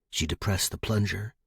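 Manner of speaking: plain reading voice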